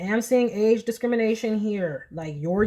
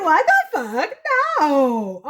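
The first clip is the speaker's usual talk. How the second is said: very high and louder, laughing disbelief; outraged elders; 9 semitones higher, 7 dB louder, slower than usual. Retold or imitated. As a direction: scoff loudly through a laugh. Elders reject the claim, laughing.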